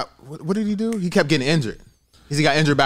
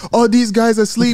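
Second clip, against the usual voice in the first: imitating a drowsy, monotone fighter; lightly mocked sleepy accented voice; mock no enthusiasm; 6 semitones higher, 4 dB louder than usual. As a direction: parody a callout with no energy in it. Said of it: "he don't have no enthusiasm".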